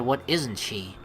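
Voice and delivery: normal talking voice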